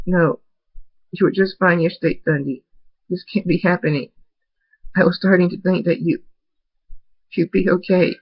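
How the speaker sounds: high, choked-up voice near tears; tearful pleading. Acted. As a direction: plead through rising tears. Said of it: "He starts crying".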